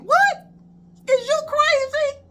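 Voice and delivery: high-pitched mock outrage; incredulous. Student told by cousin to stay put, high incredulous outcry. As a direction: squeal it, acting appalled.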